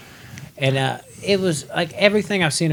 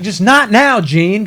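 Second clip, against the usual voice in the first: higher and louder irritated brush-off; snapping at Gene; 8 semitones higher, 5 dB louder, slower than usual. Retold or imitated. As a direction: cut him off, no time for this. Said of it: "He's like".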